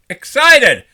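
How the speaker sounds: high, loud burst of excitement; excited exclamation. Real, not acted. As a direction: punch it out with sudden enthusiasm. Punches up own word after saying it low.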